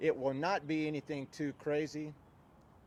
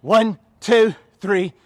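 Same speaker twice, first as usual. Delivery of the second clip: loud drill-style cadence; shouted count; 4 semitones higher, 15 dB louder, slower than usual. Real, not acted. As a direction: shout the numbers in steady rhythm. Trainer calls out exercise count.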